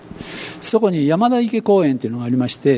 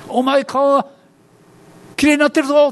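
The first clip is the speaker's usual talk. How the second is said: much higher and louder, astonished; amazed exclamation; 9 semitones higher, 3 dB louder than usual. Retold or imitated. Someone telling the Samaritan his face is healed, amazed. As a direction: blurt it out in amazement.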